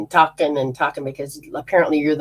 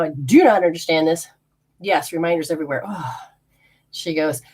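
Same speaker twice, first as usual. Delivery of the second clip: usual speaking voice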